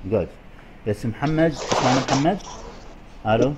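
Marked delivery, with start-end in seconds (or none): none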